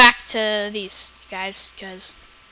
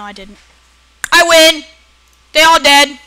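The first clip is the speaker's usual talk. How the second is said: ordinary delivery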